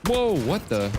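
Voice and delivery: high, loud startled whoa. Real, not acted. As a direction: caught completely off guard. Surprised in game.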